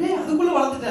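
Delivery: lightly imitating a doting visiting uncle; relative's remark. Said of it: "வந்து சொல்லுவாரு". (உயர்ந்த குரலில் உறவினரைப் போல கேலியாக)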